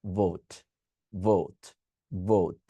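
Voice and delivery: ভোট with lips on teeth, voiced v, no puff of air; ভোট as English vote; vibrating v sound. Shown as a demonstration. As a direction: say ভোট with a buzzing v. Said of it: "কোনো বাতাস থাকবে না".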